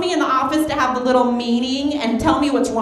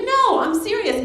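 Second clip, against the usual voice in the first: mock boss; 9 semitones higher than usual. Boss quoted.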